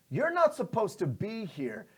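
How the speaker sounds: guard's challenge. Acted. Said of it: "Frank's like".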